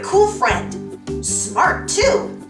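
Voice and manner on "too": usual speaking voice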